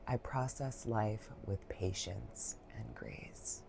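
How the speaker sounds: slow unvoiced whisper of the mantra; soft slow whispered affirmation. Shown as a demonstration. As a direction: breathe the mantra out slowly in a whisper. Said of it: "our mantra for today is".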